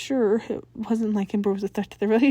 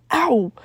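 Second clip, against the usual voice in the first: sharp yelp of pain; 4 semitones higher, 8 dB louder than usual. A narrator's reading. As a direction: cry out from a kick. Tucker kicked in shin.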